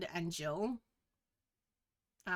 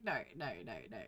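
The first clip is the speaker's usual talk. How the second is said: sheepish no; 10 semitones lower, 14 dB quieter, much faster than usual. Retold or imitated. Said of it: "I was like".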